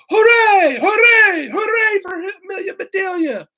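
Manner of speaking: very high, loud crowd cheer; roaring cheer. A narrator's reading. The Grizzlies cheering their hero, high roaring. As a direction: cheer like a whole team. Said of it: "heard a loud roar".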